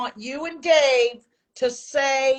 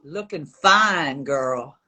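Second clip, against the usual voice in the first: deep, slow seductive line; sultry voice; 10 semitones lower, much slower than usual. Shown as a demonstration. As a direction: drag it out low and husky. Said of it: "in the most sultry voice you can".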